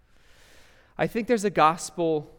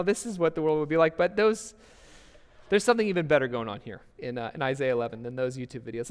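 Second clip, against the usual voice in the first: usual speaking voice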